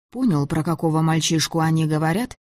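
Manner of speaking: ordinary delivery, nothing marked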